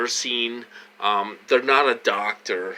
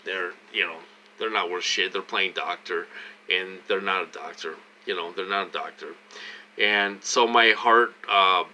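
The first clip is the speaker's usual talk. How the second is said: ordinary talk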